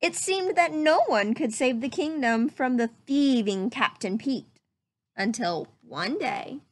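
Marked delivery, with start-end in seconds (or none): none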